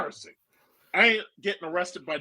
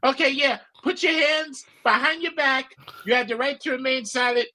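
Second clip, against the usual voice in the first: higher mock policewoman voice; mock female cop; 8 semitones higher than usual. Streamer imitating a female cop arresting him, higher mock voice. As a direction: lightly imitate a cop reading rights, pitched up. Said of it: "start that female police shit".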